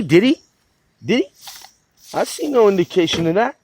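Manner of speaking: ordinary talk, nothing marked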